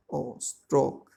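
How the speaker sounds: "O" alone, then the whole word "stroke"; isolated vowel sound. Shown as a demonstration. Teacher shows pupils the vowel, "O" apart, then "stroke". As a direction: sound out "O" separately before saying "stroke". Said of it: "Like this".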